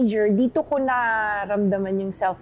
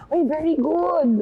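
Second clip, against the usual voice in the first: higher, slowed mock professor praise; professor's praise; 7 semitones higher, 4 dB louder, slower than usual. Guest retelling her professor's praise, mock impressed. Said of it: "sasabihin ng professor ko".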